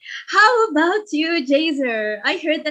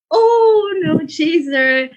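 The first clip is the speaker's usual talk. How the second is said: dismayed; 2 semitones higher, 5 dB louder, slower than usual. Real, not acted. Winner absent again.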